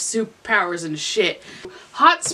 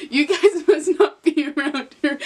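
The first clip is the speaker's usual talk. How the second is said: laughing; 4 semitones higher, 4 dB louder than usual. Acted. Voicing Naruto, laughing.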